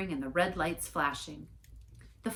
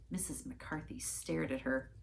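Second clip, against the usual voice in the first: ordinary delivery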